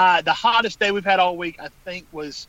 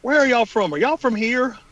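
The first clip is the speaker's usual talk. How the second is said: high, louder incredulous question; imitating puzzled waiter; 7 semitones higher, 4 dB louder than usual. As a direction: quote a baffled stranger, pitch raised. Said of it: "looked at us just kinda crazy and went".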